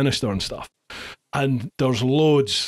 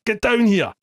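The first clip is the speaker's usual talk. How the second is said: higher, louder call down the stairwell; 8 semitones higher, 3 dB louder than usual. Retold or imitated. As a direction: shout for your mate to come down. Calling mate down the stairs.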